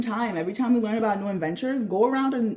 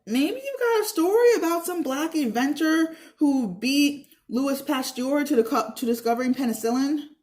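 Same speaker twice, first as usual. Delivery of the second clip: sarcastic taunt; 7 semitones higher, 4 dB louder than usual. A narrator's reading. Ella taunts Herbie.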